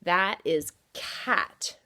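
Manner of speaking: cat drawn out slowly; slow reading. Shown as a demonstration. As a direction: read cat slowly and clearly for children. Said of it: "What is that word?".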